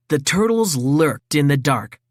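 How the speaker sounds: ordinary delivery, nothing marked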